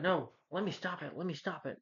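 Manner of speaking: high, swooping retold plea; mock pleading. Retold villain fight, character pleads to intervene.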